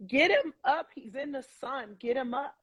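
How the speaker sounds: reliving a frantic demand without shouting; retold desperate plea. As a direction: plead insistently, pitch lifted high.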